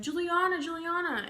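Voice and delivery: put-on boy calling her name across the street.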